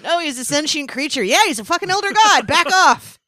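squeaky high indignant activist; mock the protester, high and huffy. Voicing Greenpeace defending Cthulhu.

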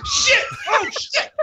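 high-pitched, yelped reaction to a burn; mock shocked outburst. Real, not acted. Friend reacting to a jab at the host, mock shocked outburst. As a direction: whoop it high, egging them on.

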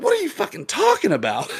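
very high-pitched mock outrage; retold disbelief. Bartender answering the man who claims ownership, high disbelief. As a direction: squeak out the incredulous comeback. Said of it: "I was like".

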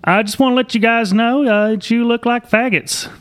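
high, sneering drive-by taunt. Retold or imitated. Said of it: "He's like".

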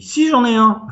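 put-on high-pitched eager child's claim; eager insistence. Pupil insisting to the teacher he has a piano, eager and high.

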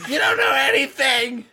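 high, hammed-up retort with a laugh; play-yell at them in a high voice; mock outrage. Grump answering a friend who mocked him, mock outrage.

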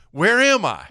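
high, loud retold question; bewildered lost boater. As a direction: play the clueless boater calling across the water. Lost boater asks his location.

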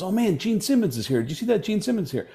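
high-pitched, hushed excitement; mock excited. Party guest tells a friend who is here, mock excited. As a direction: imitate an excited friend spotting a star. Birthday guest spotting a star.

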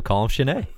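Chenet said "shuh-NAY", with a silent T; mispronounced as French. Retold or imitated. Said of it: "pronouncing it like that".

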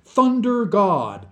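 slow, high and loud; encouraging prompt. A narrator's reading. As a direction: spell it out loudly to coax the old man. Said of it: "he encouraged".

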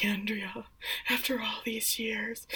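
slow, very hushed whisper; creepy whisper. Retold or imitated. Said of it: "starts whispering really creepily".

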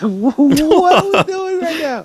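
high, slow, incredulous; baffled mock question. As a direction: ask it high and slow in disbelief. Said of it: "I'm like".

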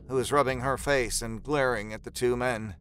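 ordinary talk, nothing marked

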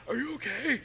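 mock-tender high query; concerned question. Acting concerned question to partner.

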